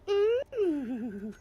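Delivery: very high squeaky cooing voice; greedy robot voice. Retold or imitated. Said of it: "Bob was like".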